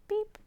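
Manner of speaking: short high-pitched beep; little truck's beep. A narrator's reading. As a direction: toot like a little truck horn. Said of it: "said Blue to the big green toad".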